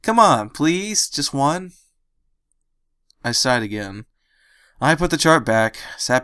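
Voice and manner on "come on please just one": begging. Acted. Dash begging.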